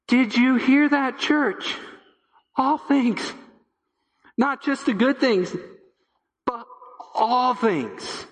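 high, ringing call to the church; fervent preaching. Real, not acted. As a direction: rouse the congregation, pitch lifted. Calling out to the church.

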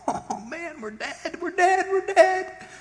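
very high, hammed-up wail; panicked young man. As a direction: squeal in comic panic to your master. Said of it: "the young man cried to Elisha".

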